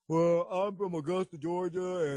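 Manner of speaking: mock southern faith-healing supplicant; afflicted churchgoer. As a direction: imitate a simple soul answering the preacher. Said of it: "he start healing people".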